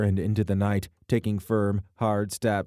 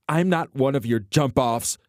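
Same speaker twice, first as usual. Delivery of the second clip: angry tearful shout; 6 semitones higher, 6 dB louder than usual. Acted. Alana, furious and near tears.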